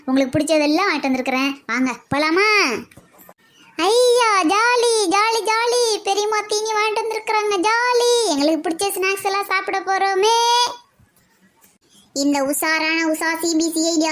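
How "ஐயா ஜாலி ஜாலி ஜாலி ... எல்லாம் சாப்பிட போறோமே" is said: put-on high, gleeful child's cheer; kids cheering. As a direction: squeal with delight over treats. Kids cheer about snacks.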